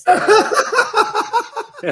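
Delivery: high, slow laughing words. Real, not acted. Funny story, laughing.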